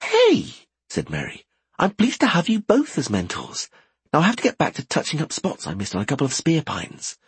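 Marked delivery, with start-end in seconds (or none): 0.0-0.5 indignant yelp. Acted. Merry mock protest. 1.8-7.2 put-on higher, brighter girl voice; young woman voice. Merry's voice.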